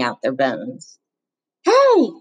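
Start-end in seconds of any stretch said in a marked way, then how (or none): 1.6-2.1 very high, loud shout. Acted. Bully shouting from the bus window, loud shout. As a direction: bark it across the yard. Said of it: "Norton shouted".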